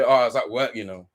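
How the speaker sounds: lightly voiced lame excuse, low, you know fading to almost nothing; mock excuse. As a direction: mimic a viewer making excuses, mildly whiny. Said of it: "you're gonna probably say".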